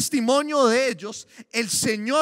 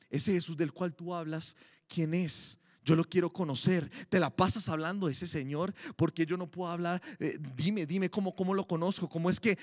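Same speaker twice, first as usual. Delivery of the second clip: hushed eager asking; 5 semitones lower, 6 dB quieter than usual. Retold or imitated. Curious listener asks about Jesus.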